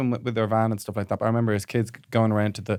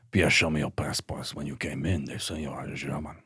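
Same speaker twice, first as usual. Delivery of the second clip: low stern guard voice; German accent; 9 semitones lower, 9 dB quieter than usual. Acted. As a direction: question him like a border guard. Border guard voice.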